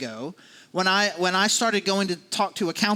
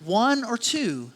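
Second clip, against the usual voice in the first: slow emphasis; 3 semitones higher, much slower than usual. Shown as a demonstration. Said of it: "But what does he say?".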